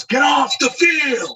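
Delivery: loud, high, drawn-out holler; stretched-out high shout; imitated groundskeeper yell. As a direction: holler it slowly across a field. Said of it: "He's yelling".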